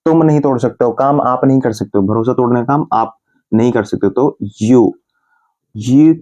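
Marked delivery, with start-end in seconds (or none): none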